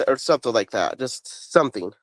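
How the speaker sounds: ordinary delivery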